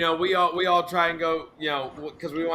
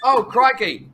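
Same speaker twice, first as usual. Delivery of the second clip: mock Australian exclamation; 4 semitones higher, 5 dB louder, slower than usual.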